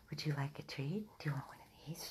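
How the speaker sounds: soft whispered offer; quiet coaxing whisper. Real, not acted. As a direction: hush it to the dogs. Whispering treat offer to dogs.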